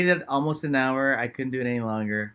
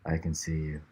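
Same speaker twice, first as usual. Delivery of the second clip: very low, soft playful voice; changed voice; 10 semitones lower, 10 dB quieter than usual. Pretend. Teasing the host during the audio test, in a changed low voice. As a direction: sink deep and quiet. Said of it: "speaking like this".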